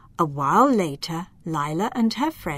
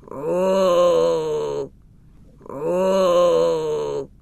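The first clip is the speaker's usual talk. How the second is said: tired groans; 3 semitones lower, 3 dB louder than usual. Acted. Fireflies landing, sounds of relief.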